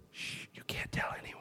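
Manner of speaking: unvoiced secret whisper. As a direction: hush her and whisper the secret. Telling girl secret ending.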